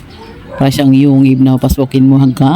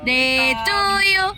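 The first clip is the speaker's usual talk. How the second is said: slow coaxing; 9 semitones higher, 7 dB quieter, slower than usual. Shown as a demonstration. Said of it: "coaxing parrot".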